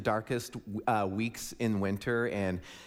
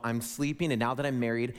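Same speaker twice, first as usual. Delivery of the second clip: ordinary talk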